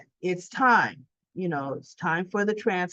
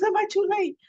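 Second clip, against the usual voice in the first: much higher, breathless mock worry; anxious question; 13 semitones higher, faster than usual. Trina arriving at the precinct, anxious and high. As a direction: ask fearing the answer.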